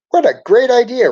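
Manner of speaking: high, loud delighted burst; light up with joy; exclaiming. A narrator's reading. Ralphie thrilled that class came to him, excited. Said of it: "Ralphie exclaimed".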